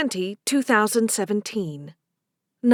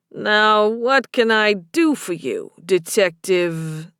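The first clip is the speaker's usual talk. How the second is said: slow Boston bartender voice; Boston barman, unhurried; thick Boston accent; 3 semitones lower, slower than usual. A narrator's reading. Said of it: "a man with a thick Boston accent".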